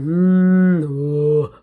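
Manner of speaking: loud low moaning hum into an oh; labor moan. Retold or imitated. Mom shows how she moaned in labor, low hum. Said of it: "I'm an alto, so it was very, very low".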